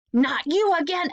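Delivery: exasperated yell at an unseen voice; annoyed shout. Acted. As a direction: throw it up at the narrator, fed up. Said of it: "Evan shouted at the strange voice".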